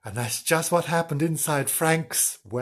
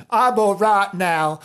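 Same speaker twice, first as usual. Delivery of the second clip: loud, slow dog voice; big slow bark of reassurance; woofing dog; 4 semitones higher, 5 dB louder, slower than usual. Acted. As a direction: woof it out, drawn out and raised. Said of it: "He waffed".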